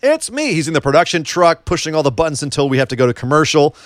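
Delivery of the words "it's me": high mock whine. Host echoing the Sami Zayn bit, high mock whine. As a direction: squeak it out like a whiny heel.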